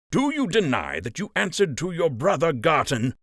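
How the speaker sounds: accusing captain. Acted. Captain accusing Wilfred of betrayal, hard accusing tone. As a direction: press the charge in his face.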